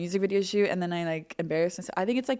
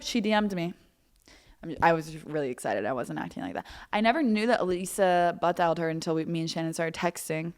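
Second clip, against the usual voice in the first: ordinary talk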